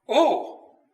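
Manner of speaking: put-on boy startled by a bee in the attic; alarmed exclamation. A narrator's reading. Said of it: "he exclaimed".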